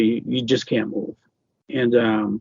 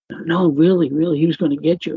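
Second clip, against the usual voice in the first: retold urgent insistence, pitched up; insistent child; 5 semitones higher than usual. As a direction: imitate a child insisting to a sibling. Child insisting to scared sister.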